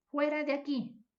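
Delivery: high, loud command to leave; angry order. Shown as a demonstration. Teacher orders someone out, loud and angry. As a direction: throw someone out, sharply. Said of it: "Esa es una orden".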